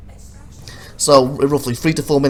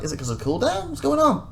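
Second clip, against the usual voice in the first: confused; 13 semitones higher than usual. Retold or imitated. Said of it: "I'm like".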